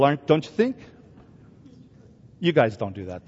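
ordinary talk

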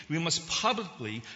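"publicly" set out with emphasis; stress on PUBLICLY. Real, not acted. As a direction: underline the word "publicly" with your voice. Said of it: "I underline the word publicly".

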